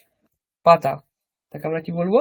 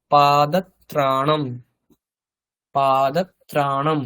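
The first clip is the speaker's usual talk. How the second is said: slow modeling; 2 semitones lower, slower than usual. Shown as a demonstration. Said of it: "teacher models Sanskrit word".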